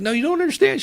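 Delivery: high, loud, squealing disbelief; ecstatic imitated squeal. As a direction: squeal it like you can't believe you did it. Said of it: "she called me the next day crying".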